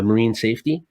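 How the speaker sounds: normal talking voice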